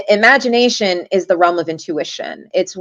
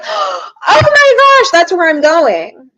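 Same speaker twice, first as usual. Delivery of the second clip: much higher and louder, slow shocked squeal; astonished; 10 semitones higher, 7 dB louder, much slower than usual. Retold or imitated. Client stunned the reader guessed Arrowhead, high slow astonished cry. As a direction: squeal it out slowly in disbelief.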